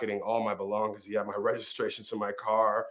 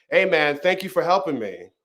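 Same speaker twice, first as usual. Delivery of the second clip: higher, louder retold call; yelling out; 6 semitones higher, 7 dB louder than usual. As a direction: call out like the kid to someone passing. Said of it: "I heard the kid yell out".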